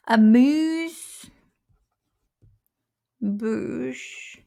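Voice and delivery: very slow, drawn-out AMUSE-BOUCHE; sounded out. Real, not acted. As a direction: stretch Amuse-bouche right out with a long gap between the words. Sounding out the French term.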